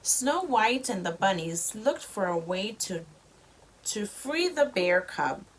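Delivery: ordinary delivery, nothing marked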